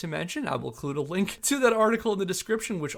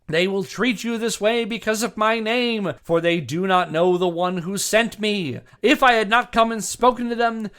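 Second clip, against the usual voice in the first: preaching scripture; 2 semitones higher, 8 dB louder than usual. Retold or imitated. [name] quoting Jesus to Referee Tunis, preaching loudly. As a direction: preach the Gospel verses at the referee like a pulpit. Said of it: "back to [name]".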